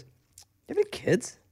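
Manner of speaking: high, soft prying neighbor voice; mock the nosy woman asking, high and hushed; imitating the neighbor. Neighbor voiced in retold story.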